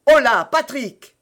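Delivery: loud, high call to a servant. A narrator's reading. Buckingham calling Patrick for help, loud shout.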